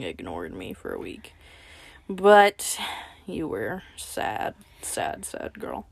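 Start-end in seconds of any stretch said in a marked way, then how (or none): none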